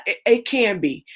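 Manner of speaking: normal talking voice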